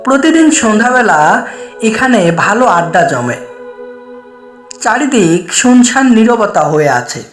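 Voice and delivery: ordinary delivery, nothing marked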